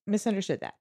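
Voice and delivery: usual speaking voice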